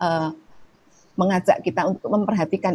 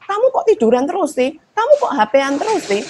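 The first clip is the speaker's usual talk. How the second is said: raised, louder exasperated scolding; annoyed nagging; 8 semitones higher, 4 dB louder than usual. Retold or imitated. Parent nagging a child glued to the phone, exasperated. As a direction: nag the child in irritation, higher and louder. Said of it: "Biasanya kan kita kalau kesel".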